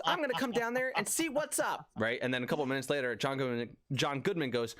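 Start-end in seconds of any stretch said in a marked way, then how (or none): 0.0-1.9 high voice, imitating the dean; quoting the dean. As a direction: play the dean, pitched way up. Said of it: "So the dean goes".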